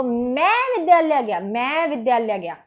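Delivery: long emphatic "मैं" before "विद्यालय गया"; stress on मैं. Shown as a demonstration. I went alone to school, stress on "मैं". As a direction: stretch "मैं" with a swell, then fall away. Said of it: "मैं पे ज्यादा बल दिया गया है".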